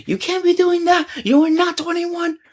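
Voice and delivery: high-pitched screeched telling-off; hammed-up shrill-pitched outrage; imitated scolding. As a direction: mimic an irate shopkeeper berating a kid. Woman berates the kid.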